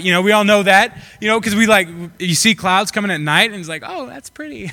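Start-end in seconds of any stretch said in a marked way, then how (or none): 3.9-4.7 high, soft naive cooing; mock admiration. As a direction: admire it like a clueless sky-gazer. Acts out naive sky watcher.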